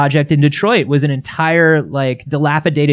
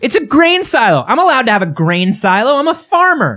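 indignant high; 8 semitones higher than usual. Acted. Indignant defense.

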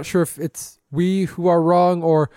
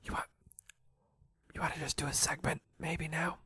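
very soft, tentative whisper; 14 dB quieter, faster than usual. Acted. Host coaxing woken co-host into a segment, whispering. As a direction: whisper it gently to the drowsy. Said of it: "We're whispering because Johannes is asleep".

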